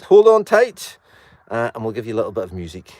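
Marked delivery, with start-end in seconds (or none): 0.0-1.0 high, loud call to hold on. Real, not acted. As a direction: shout a warning to the passengers. Warning riders in the mule.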